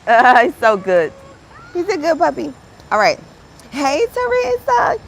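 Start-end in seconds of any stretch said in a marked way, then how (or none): 0.1-2.5 doting praise. Real, not acted. Praising the dog. 3.7-5.0 sing-song greeting. Real, not acted. Greeting a viewer.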